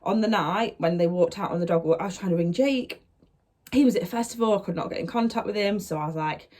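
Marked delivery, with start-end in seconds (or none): none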